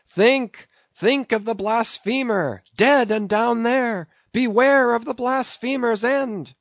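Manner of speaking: high, ringing prophet's warning cry; vehement exclamation. A narrator's reading. Gabriel crying a warning at Ahab about the blasphemer, vehement and high. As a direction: cry it out like a doom-sayer pointing down. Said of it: "vehemently exclaimed with downward pointed finger".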